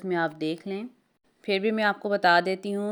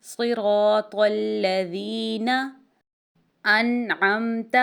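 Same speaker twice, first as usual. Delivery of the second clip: slow, measured recitation of صراط الذین انعمت; 3 dB louder, much slower than usual. Shown as a demonstration. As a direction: recite word by word, unhurried. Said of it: "اس کو ہم لمبا نہیں کریں گے". (آہستہ تلاوت)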